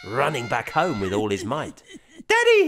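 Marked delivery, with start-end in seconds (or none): 2.3-2.7 put-on high child's cry, much louder. A narrator's reading. Boy calling his daddy in alarm, high loud cry. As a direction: cry out like a frantic little boy. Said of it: "he cries with a gasping gush".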